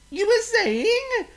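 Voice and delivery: cheeky bird from the air; teasing bird voice; bird calling down. Acted. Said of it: "he called down to Oink".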